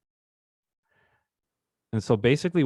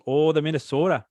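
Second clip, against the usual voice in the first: higher-pitched attempt at a Minnesota accent; 6 semitones higher, 4 dB louder than usual. Shown as a demonstration. Host trying Minnesota on a co-host's request, Minnesota accent. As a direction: have a playful go at Minnesotan, pitched up. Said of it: "How about your Minnesota accent?".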